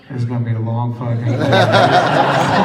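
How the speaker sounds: loud laughing groan from the crew. Retold or imitated. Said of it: "the whole crew's kinda like".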